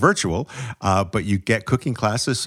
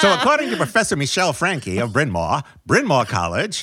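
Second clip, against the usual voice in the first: put-on old-time fast-talking movie newsman; 1940s news voice; 2 semitones higher than usual. Co-host reads food news on request, 1940s news voice. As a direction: play a vintage Hollywood news reporter. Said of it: "fast-talking news voice that you have?".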